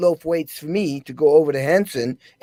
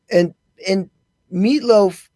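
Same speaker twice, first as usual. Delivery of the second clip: normal talking voice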